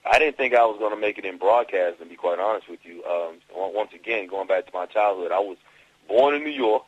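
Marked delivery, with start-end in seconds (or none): 6.1-6.8 louder, higher New York accent. Shown as a demonstration. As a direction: put on a brash New York accent, with attitude. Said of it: "I had that New York accent".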